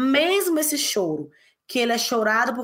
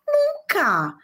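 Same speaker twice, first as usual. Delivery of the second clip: emphatic cry; 8 semitones higher than usual. Real, not acted. Emphatic answer to own question.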